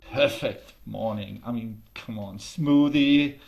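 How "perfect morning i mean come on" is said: plain reading voice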